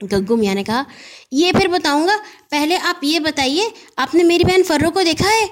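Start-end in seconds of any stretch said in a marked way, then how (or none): 1.3-5.5 put-on high, eager little boy asking in a rush; a worried boy firing questions; young boy Gaggu. Anxious boy Gaggu pesters the postman, high and eager.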